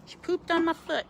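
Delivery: high, loud surprised exclaim. Real, not acted. Handler reacts as the owl poops on her foot, surprised squeal.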